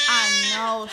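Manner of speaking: crying laugh. Real, not acted. Upset vent, laugh turning to cry.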